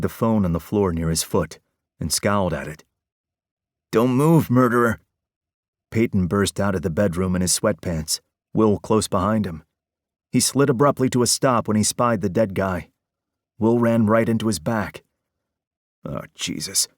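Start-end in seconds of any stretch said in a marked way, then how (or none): 3.9-5.0 loud, higher shout of don't move; shouted order. Acted. The twin yells at the supposed murderer, loud alarm. 16.0-16.9 dry mutter. Acted. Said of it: "Peyton said eloquently".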